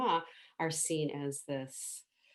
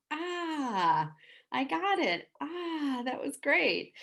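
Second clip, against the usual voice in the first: higher, louder satisfied Ah, I got it; 10 semitones higher, 5 dB louder, slower than usual. Shown as a demonstration. Demonstrating a sound of satisfaction, higher and louder. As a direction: sigh out pleased relief. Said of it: "We go".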